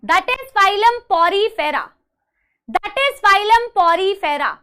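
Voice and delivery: "Porifera" very slow, each part drawn out; teacher modelling "Porifera" for repetition; say-along pronunciation. Shown as a demonstration. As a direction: lead the class slowly through "phylum Porifera". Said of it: "मेरे साथ साथ में बेटा इसको बोलेंगे".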